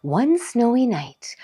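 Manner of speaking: ordinary delivery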